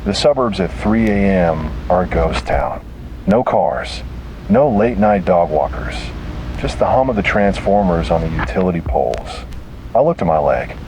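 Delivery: ordinary delivery, nothing marked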